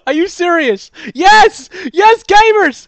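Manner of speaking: high, loud triumphant yell; excited victory scream. Real, not acted. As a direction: scream it out as you win. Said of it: "What was that scream?".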